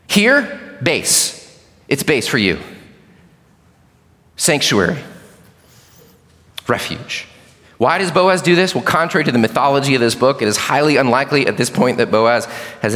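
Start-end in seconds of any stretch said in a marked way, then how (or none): 0.1-7.3 reassuring. Retold or imitated. Boaz assuring Ruth of safety.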